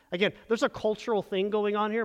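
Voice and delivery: usual speaking voice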